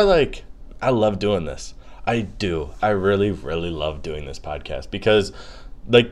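ordinary talk

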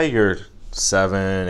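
normal talking voice

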